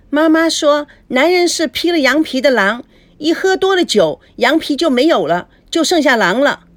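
put-on high, loud child reciting; a kid blurting out mom's saying; little girl announcing, pitched high; knowing little girl. Feifei quotes her mother.

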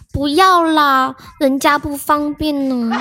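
put-on slow, coy Taiwanese accent. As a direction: drawl it sweetly in Taiwanese accent. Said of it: "点点阿姨用台湾腔说".

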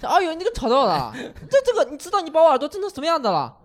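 put-on high-pitched, laughing scold in a Shanghai accent; a fussy lecture in a mock Shanghai accent; Shanghainese accent imitation. Neighbour in a Shanghai accent scolds the narrator, laughing. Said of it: "你这个模仿的口音".

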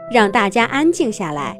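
ordinary delivery

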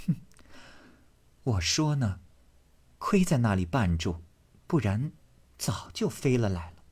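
soft, sneering, jealous jab; cold sneer. Acted. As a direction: needle him quietly. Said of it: "黛玉冷笑道".

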